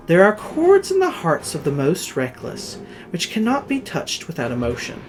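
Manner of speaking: usual speaking voice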